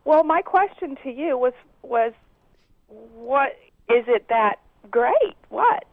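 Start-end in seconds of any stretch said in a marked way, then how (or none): none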